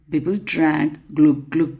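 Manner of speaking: ordinary delivery, nothing marked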